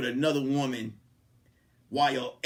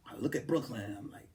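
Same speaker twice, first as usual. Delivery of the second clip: normal talking voice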